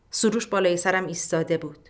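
ordinary delivery

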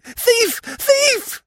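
very high, loud, shrill yells. A narrator's reading. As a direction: shriek for help in panic. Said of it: "She uttered absolute yells".

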